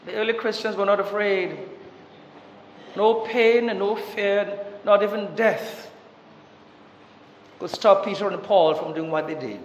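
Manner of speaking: ordinary delivery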